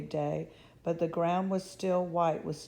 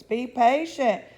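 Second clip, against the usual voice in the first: loud, slow, higher call; crying out; 6 semitones higher, 9 dB louder, slower than usual. A narrator's reading. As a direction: hold the kids back sternly. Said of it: "cried the fathers".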